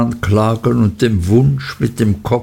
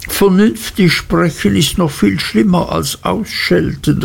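put-on higher, young wife's voice in despair; despairing young wife, voice pitched up; despairing cry; 9 semitones higher than usual. A narrator's reading. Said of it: "rief Dora voll Verzweiflung".

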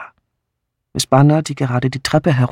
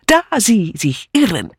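triumphant; 7 semitones higher than usual. Acted. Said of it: "rief Mitzi triumphierend".

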